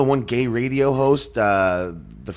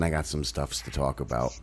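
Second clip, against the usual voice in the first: ordinary delivery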